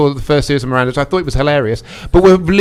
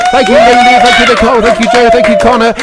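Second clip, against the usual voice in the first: raised, high sign-off thanks; loud, high roll-call of thanks; 5 semitones higher, 6 dB louder than usual. Real, not acted. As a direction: call out each thank-you over the applause. Signing off over applause.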